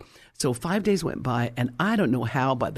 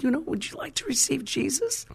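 softer, higher, tender asking; gentle invitation; 11 semitones higher, 5 dB quieter than usual. Retold or imitated. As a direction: coax her softly toward faith. Gently inviting friend to faith.

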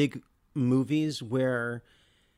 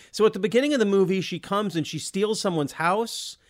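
higher-pitched mock bewilderment; confused viewer; 7 semitones higher than usual. Podcaster voicing viewers who missed the references, confused. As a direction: imitate someone puzzling through a plot.

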